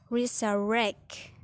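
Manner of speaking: "resurrect" slowly, with RECT stressed; final-syllable stress. Shown as a demonstration. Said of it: "final syllable is strong".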